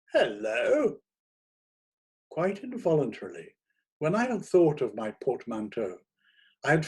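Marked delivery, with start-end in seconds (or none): 0.1-1.0 high, rising "Hello!" of surprise; a high-pitched, astonished exclamation; surprised hello. A narrator's reading. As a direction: catch yourself out with a startled hello. Surprised at own theatrical gesture.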